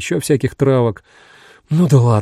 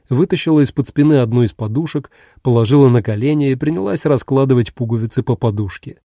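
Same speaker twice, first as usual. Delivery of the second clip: ordinary delivery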